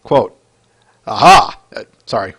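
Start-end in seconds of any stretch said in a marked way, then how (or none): 1.1-1.6 wrong voice. Shown as a demonstration. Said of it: "wrong, wrong voice".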